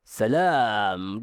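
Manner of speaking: "Salam" low and swallowed in the throat. Shown as a demonstration. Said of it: "Dia suara tenggorokan".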